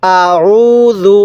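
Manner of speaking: আউযু read very slowly with a soft জ; soft zal. Shown as a demonstration. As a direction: soften the জ in আউযু and stretch it. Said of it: "জালটা নরম করে".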